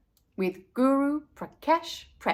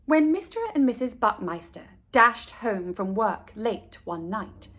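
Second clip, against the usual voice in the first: ordinary delivery, nothing marked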